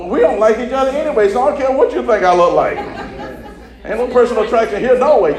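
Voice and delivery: lively laughing preaching; sassy preaching. Acted. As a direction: toss it off with a laugh.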